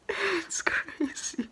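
very slow high whisper; awed whisper. Real, not acted. Thinking of her supportive friends, slow awed whisper. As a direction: draw it out in a whisper. Overwhelmed gratitude.

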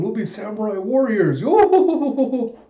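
high voice rising into a loud whoop; hammed-up gleeful character cry; Brook, exultant. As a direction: crow it, ending on a long high "yo".

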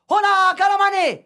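high, loud mock chief's call; hammed-up chief's cry. The chief arrives calling out.

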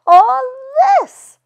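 laughing knowingly. A narrator's reading. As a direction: laugh through it, she knows this one. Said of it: "laughed Millie".

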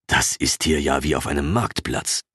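ordinary delivery, nothing marked

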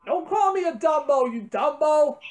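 ordinary talk, nothing marked

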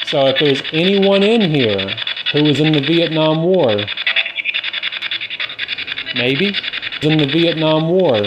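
calling out. Real, not acted. Calling out to veteran spirits.

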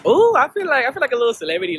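high, loud and thrilled; excited. Real, not acted. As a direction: gush like a star. Feels like celebrity.

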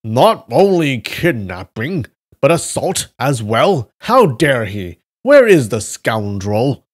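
loud, slow indignant shouting. Acted. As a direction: shout over the noise. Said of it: "They shouted above the cacophony".

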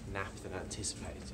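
normal talking voice

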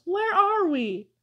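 high, drawn-out whiny question; plaintive, stretched-out complaint; complaining. A narrator's reading. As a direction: whine it slowly, pitch well up. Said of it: "complained Amos".

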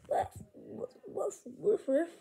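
short, high play woofs; dog barking. Pretend. Child voices a toy dog, high pretend barking. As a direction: bark like a little dog.

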